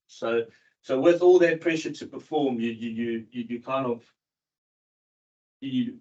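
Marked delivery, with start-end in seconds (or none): none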